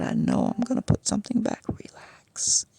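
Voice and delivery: unvoiced hurried whisper. She whispers back to the worker, hurried whisper. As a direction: hiss it under your breath, quick.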